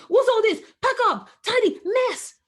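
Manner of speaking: loud, high, sharp telling-off; scolding parent. Retold or imitated. Mother mock-scolds her son over his toys, loud and high. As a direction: bark at the child over the mess. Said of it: "So I'm not saying to him".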